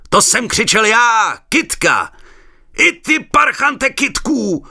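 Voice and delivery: slow loud high yell; shouting through cupped hands. A narrator's reading. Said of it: "stulil dlaně v troubu a křičel".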